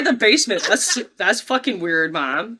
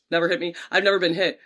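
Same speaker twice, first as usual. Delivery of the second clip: ordinary talk, nothing marked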